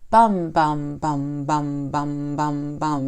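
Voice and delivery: "Bum, bum, bum" tapped out evenly, each one equally spaced; consistent beat. Shown as a demonstration. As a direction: chant "bum, bum, bum" low and steady to mark a poem's rhythm. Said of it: "It has that consistent beat to it".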